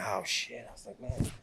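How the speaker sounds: hushed, whispered reaction as if to himself; barely voiced awe; awed whisper. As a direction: retell it under your breath, amazed. Retells his awed reaction to himself.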